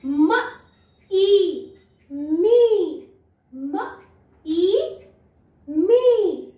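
teacher blending म and ई; sounding out letters. Shown as a demonstration. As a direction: say म and ई apart, then blend to मी. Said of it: "यहाँ पे भी open है।".

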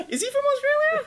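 very high, laughing question; squeaky disbelief. Pretend. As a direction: squeal it in mock shock.

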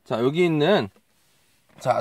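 ordinary delivery

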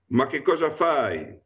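mock cry of alarm from the old master; shrieked. A narrator's reading. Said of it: "strillò". (strillo accennato, più acuto)

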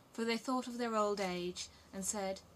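usual speaking voice

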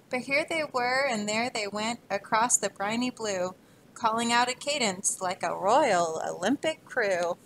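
put-on rhythmic read-aloud to children; story reading. Teacher reading a rhyming book to kids, lively. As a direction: read the rhyme to a class, bouncing along.

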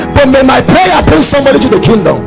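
ordinary delivery

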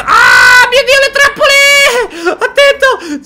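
high, loud startled cry; scared shout. Real, not acted. Gamer spotting traps, frightened shout. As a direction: yell at the sudden traps.

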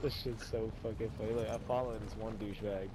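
normal talking voice

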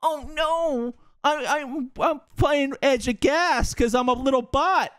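high, whiny mock voice of a scared player. Coach mocking his player's cowardly play, high whiny mock voice. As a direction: whimper it like a timid little bot. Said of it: "and what do you do?".

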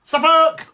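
"Suffolk" called out high; demonstrated pronunciation. Shown as a demonstration. Host teaching listeners to say it, "Suffolk" in a high call. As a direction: pitch "Suffolk" right up. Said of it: "you gotta say it like".

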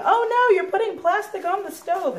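high, alarmed voice of a fussy commenter; playful mock alarm at the stove; mock panicky viewer. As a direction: squeal it like a worried viewer. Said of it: "please do not start panicking".

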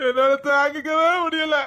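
high, drawn-out tearful wail; crying. Acted. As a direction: wail it slowly through sobs. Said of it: "அழுதுகிட்டு இருக்க".

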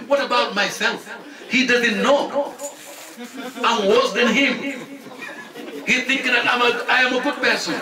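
high, laughing confession; earnest self-mocking laughter; laughing self-reproach. Real, not acted. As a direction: laugh at yourself while confessing your faults. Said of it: "I'm telling you".

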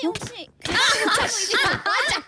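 normal talking voice

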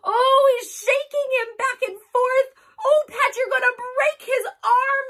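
high, loud panicky wail; upset over the teddy; very high alarmed pleading; distressed cry. Acted. Dog shakes teddy.

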